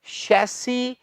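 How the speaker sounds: शैसी said softly with sh; soft careful model. Shown as a demonstration. Teacher modelling the right sound for students: soft शैसी. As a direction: murmur शैसी gently with sh. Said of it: "होता है इसका उच्चारण".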